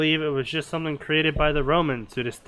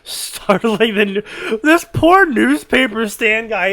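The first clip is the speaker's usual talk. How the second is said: dramatic build; 6 semitones higher, 8 dB louder, slower than usual. Acted. Dramatic narration of collapse.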